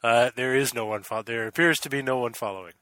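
ordinary talk

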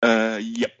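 ordinary delivery

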